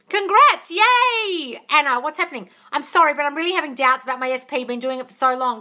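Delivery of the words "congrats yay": high, loud congratulations; bright happy yay; cheering. Real, not acted. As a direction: cheer her on. Cheering a viewer.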